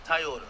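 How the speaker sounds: Toyota as "Tai-yota", firmly; Jamaican pronunciation. Shown as a demonstration. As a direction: demonstrate Toyota starting with "tie". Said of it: "We don't say Toyota".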